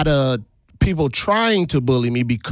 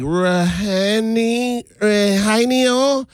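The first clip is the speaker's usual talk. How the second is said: slow, high, unsure attempt at Rahanio; higher, halting mispronunciation of Rahanio; teacher struggling with name; 8 semitones higher, much slower than usual. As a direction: stretch Rahanio out like a puzzled old teacher. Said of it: "she's like".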